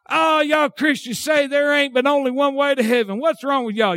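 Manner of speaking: mildly hammed-up grievance; mock complaint. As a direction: complain like an exasperated skeptic. Mimics objector to Christians.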